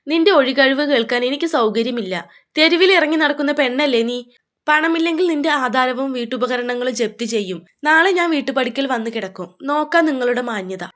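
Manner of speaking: ordinary delivery, nothing marked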